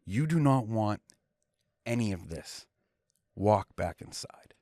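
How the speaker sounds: low, slow threat to the guard; menacing warning. Retold or imitated. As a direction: drop low and slow, daring him. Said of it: "I go like this".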